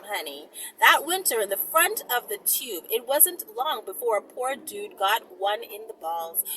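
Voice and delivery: ordinary delivery, nothing marked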